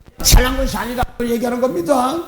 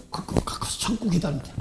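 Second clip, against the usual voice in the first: whisper; 4 semitones lower, 10 dB quieter than usual. Retold or imitated. Pastor's quiet advice retold.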